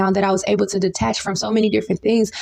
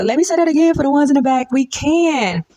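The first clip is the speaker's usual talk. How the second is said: call to the back; 5 semitones higher than usual. Real, not acted. Calling out to the back.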